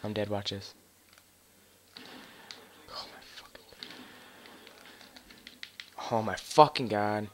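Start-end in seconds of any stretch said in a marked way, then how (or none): 2.9-3.6 breathless whispered disbelief; whispered shock. Real, not acted. Shocked at game moment. 6.1-7.3 slow, loud exasperated outburst; raging gamer. Real, not acted. Outburst at game moment.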